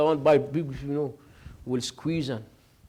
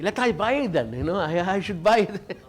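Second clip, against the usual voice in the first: raised, louder eager voice; eager to buy; 4 semitones higher, 5 dB louder than usual. Retold or imitated. Brother eagerly insisting he will buy the tasbih, raised and louder. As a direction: jump at the chance to buy it.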